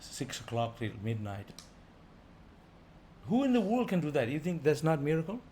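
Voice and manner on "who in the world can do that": rhetorical exclaim. Real, not acted. Rhetorical challenge to listeners.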